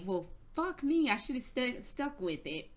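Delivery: ordinary talk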